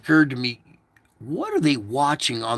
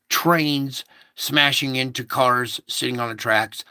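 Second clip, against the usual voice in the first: ordinary talk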